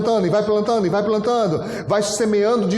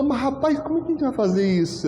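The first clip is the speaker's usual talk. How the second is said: puzzled; 6 semitones higher than usual. Retold or imitated. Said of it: "Ele falou".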